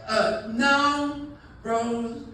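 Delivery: mock sheepish old man on the phone; sheepish excuse. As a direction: drag out a ridiculous excuse. Charles's excuse.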